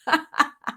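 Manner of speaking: high burst of laughter; real tickled giggle; amused laugh. Real, not acted. Host amused by a viewer's name, high laugh.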